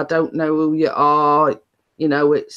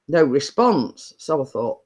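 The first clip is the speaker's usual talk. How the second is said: usual speaking voice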